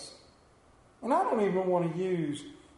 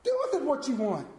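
high, dismissive go ahead, then quicker; God, letting go; 8 semitones higher, faster than usual. Retold or imitated. God tells the stubborn person go ahead, dismissive and high. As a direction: throw it back as if to say suit yourself. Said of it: "And God says".

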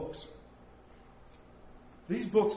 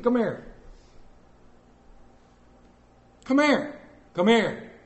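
raised-voice commands with long gaps; hollered summons; 4 semitones higher, 4 dB louder than usual. Acted. Father calling his sixteen-year-old children, hollering. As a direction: call out firmly for an absent teenager. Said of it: "Was I not hollering loud enough".

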